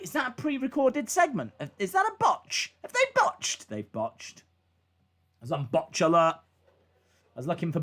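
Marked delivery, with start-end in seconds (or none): 0.0-3.7 climbing higher with each question; rising disbelief. Retold or imitated. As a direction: push the pitch up line by line. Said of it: "I was like".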